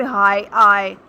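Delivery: "हाई आए" loud and drawn out; slow, loud "हाई" with a held "आए"; long stressed vowel. Shown as a demonstration. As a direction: punch and stretch "हाई", then "आए". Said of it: "ये longer vowel use हुआ हुआ है".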